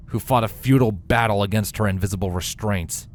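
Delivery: usual speaking voice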